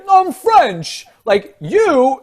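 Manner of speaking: ordinary talk, nothing marked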